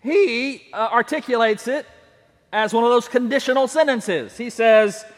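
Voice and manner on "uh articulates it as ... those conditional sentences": ordinary talk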